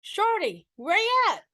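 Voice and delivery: high calling out down the street; big voice hollering a name; booming call. A narrator's reading. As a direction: call out for him across the street. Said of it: "heard a booming voice cry out".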